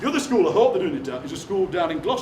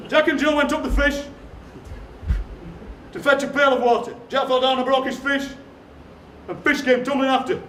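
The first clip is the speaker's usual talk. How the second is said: higher, projected verse reading; nursery rhyme recital; 5 semitones higher than usual. Acted. Poet reads a daft nursery rhyme to the audience, projected recital. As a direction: recite it out to the whole room.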